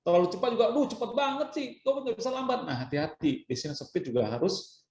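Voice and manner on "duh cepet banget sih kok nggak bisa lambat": high-pitched mock complaint; annoyed listener. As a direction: whine like an audience member who can't keep up. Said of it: "membuat hadirin merasa kesal". (keluhan kesal dengan nada tinggi)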